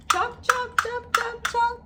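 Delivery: high, loud playful chant of chomps; lively sing-song chomps; excited chomping. Acted. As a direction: bounce each chomp for the children.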